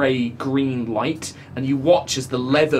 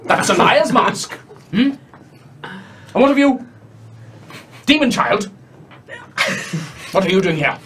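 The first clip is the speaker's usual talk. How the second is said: mocking monster; 6 semitones higher than usual. Eye monster mocks the liar and his demon child, high taunt. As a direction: taunt them from above.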